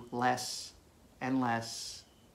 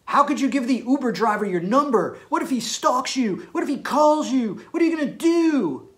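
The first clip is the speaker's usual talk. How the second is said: rising, higher and louder anxious questions; panicky worried boyfriend; 9 semitones higher, 6 dB louder than usual. Retold or imitated. Jealous boyfriend panicking at his girlfriend, mock worried. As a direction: fret over her like a worried boyfriend. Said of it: "if you say".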